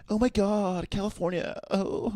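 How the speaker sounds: very high, hammed-up California girl; squeal-pitched mock Californian. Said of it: "that's, like, a stereotype".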